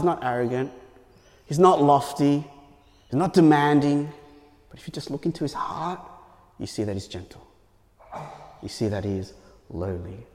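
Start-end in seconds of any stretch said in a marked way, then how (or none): 4.7-10.3 hushed. Real, not acted. Preacher softens on Jesus' heart.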